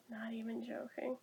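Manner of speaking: breathy whisper, no voice; whispered aside. Real, not acted. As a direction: drop to a secret whisper. Whispered aside about the movie.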